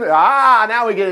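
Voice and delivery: ordinary delivery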